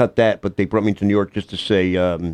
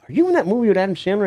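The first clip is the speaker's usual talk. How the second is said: imitating a woman; 11 semitones higher, faster than usual. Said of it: "she goes".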